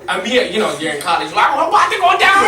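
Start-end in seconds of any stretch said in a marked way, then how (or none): 1.6-2.5 much higher, louder excitement; pumped before the brawl; hyped-up. Retold or imitated. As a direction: college friends hyping each other up. Friends hyped for a fight.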